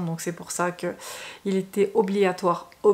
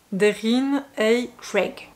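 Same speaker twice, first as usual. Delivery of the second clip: slow, careful English accent on the author's name; self-conscious English accent; 4 dB louder, much slower than usual. Shown as a demonstration. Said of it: "On admire mon accent anglais".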